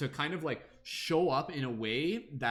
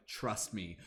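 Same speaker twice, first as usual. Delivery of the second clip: calm reassurance; 4 semitones lower, 6 dB quieter than usual. Acted. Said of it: "the universe is just like".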